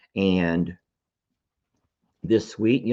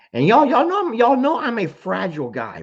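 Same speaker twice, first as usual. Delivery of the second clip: voice breaking; 12 semitones higher, 4 dB louder than usual. Real, not acted. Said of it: "I'm gonna get emotional again".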